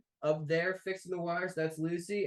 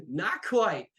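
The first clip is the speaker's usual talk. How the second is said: curt reply; 5 semitones higher, 6 dB louder than usual. Acted. GM voices Phantasma's terse reply.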